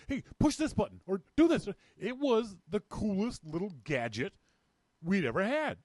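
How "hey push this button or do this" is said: higher and louder, eager to show off; excited urging. Retold or imitated. To friends in the coffee shop, eager and excited. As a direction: egg friends on to try the gadget. Showing friends the new phone.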